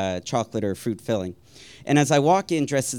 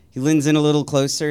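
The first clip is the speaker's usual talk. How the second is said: usual speaking voice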